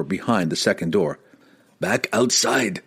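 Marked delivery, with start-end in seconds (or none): 1.8-2.8 restrained, drawn-out yell; narrator's contained yell in character; Wulfgar yelling. A narrator's reading. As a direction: call out an order without full volume. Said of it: "yelled Wulfgar".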